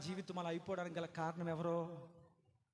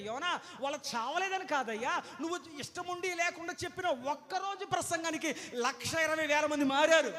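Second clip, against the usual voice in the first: rising rebuke; 7 semitones higher, 6 dB louder than usual. Acted. Preacher rebuking Jonah.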